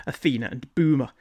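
usual speaking voice

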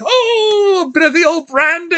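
doctor fussing over the stiff hand, high put-on voice; quoting the doctor. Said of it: "he's like".

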